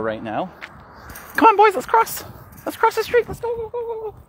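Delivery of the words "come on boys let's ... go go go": very high sing-song call to the puppies; calling the dogs. Real, not acted. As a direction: coax the dogs across the street in a squeaky voice. Calling his dogs across road.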